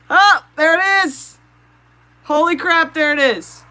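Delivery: high, loud burst of real excitement; excited outburst. Real, not acted. Streamer yelling as her fish escapes the tank, thrilled. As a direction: shout it as the fish climbs out. Fish finally climbs out, live.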